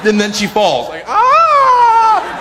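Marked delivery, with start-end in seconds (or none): 1.1-2.4 very high, loud mock scream of horror; movie scream. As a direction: shriek as the princess drops. Princess falls, he screams.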